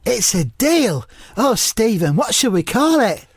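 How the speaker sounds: high, drawn-out comic impression; Mark Lawrenson. Mark seals the deal with Stephen, in high impression. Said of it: "I'll just say them as Mark".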